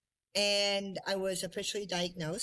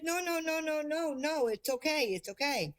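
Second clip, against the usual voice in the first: reassuring doctor; 6 semitones higher than usual. Retold or imitated.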